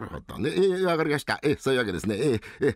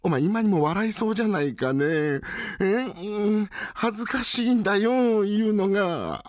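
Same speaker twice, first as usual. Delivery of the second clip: high, bashful whine; embarrassed son hesitates to confess; shy young master; 9 semitones higher than usual. Acted.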